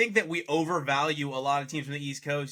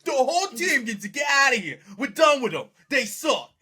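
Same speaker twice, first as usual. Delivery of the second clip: loud, high mock Boston accent shouting; 10 semitones higher, 6 dB louder than usual. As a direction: yell like an angry Boston fan in a bad accent. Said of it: "That was the worst Boston accent I've ever seen".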